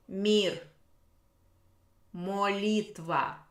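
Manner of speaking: each word read slowly and deliberately, with pauses; slow vocabulary reading. Shown as a demonstration. Teacher slowly reading vocabulary words to the class. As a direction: read the list out word by word for the students.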